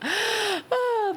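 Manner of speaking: high squealing laugh after a scare; shrill startled laugh. Real, not acted. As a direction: let out a high shocked laugh.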